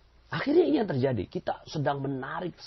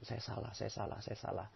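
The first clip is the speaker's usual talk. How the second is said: much softer, almost breathed; hushed confession; 15 dB quieter than usual. Shown as a demonstration. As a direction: murmur it to your own reflection. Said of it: "Jadikan cermin ajaib".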